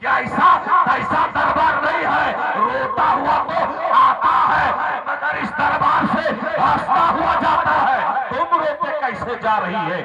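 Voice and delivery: high, loud declamatory preaching; full-force preaching. Acted. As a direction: proclaim it high and forceful to the crowd.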